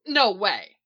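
loud, emphatic protest; firm, loud objection. A narrator's reading. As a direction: blurt it out in disbelief. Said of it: "Sisko protests".

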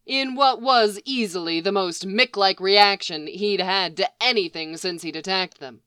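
plain reading voice

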